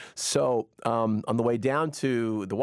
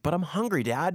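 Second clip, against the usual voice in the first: higher-pitched mock kid complaint; whiny child; 5 semitones higher than usual. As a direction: whine like a hungry kid to Dad. Plays child answering scolding dad.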